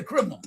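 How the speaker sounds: "criminal" squashed to "crimmuh", meaning Christmas; Atlanta pronunciation. Shown as a demonstration. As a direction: show how they swallow the end of "criminal". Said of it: "They say criminal".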